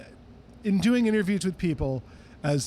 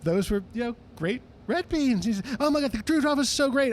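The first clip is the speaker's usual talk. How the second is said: [name] voice; 7 semitones higher than usual. Retold or imitated. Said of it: "he finally stops and goes".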